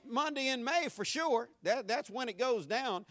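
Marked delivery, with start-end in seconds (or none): none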